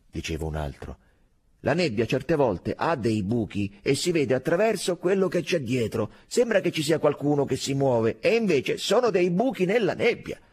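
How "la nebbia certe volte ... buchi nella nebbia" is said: high, loud soldier explaining over the crowd; excited arguing. A narrator's reading. Soldier explains the fog to his comrades, excitedly. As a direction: argue your theory eagerly, voice raised.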